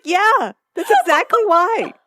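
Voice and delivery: high, mock-sincere sarcasm; sarcastic Lorelai. Lorelai sarcastically answering Rory, high and mock-sincere.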